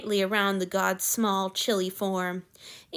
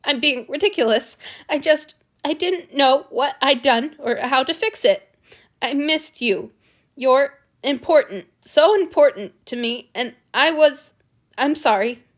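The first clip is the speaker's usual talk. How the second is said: tearful; 5 semitones higher, 7 dB louder than usual. A narrator's reading. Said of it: "crying too".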